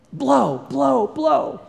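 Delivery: put-on high, loud child's plea to blow; a kid wincing from mercurochrome, pleading; blowing on a wound. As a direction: beg mom to blow on the sting. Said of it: "a word that I've always used".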